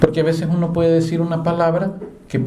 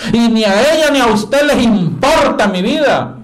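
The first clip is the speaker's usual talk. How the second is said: furious yelling; 7 semitones higher, 5 dB louder than usual. Retold or imitated. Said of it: "una señora bien furiosa".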